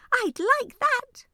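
very high, loud little squeak; squeaky voice. Acted. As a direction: squeak it like a tiny mouse. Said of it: "says a squeaky voice".

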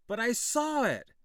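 high, loud insisting; pleading protest. A narrator's reading. Boy protesting to the men, high and loud. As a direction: make them believe you.

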